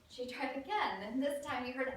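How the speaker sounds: plain reading voice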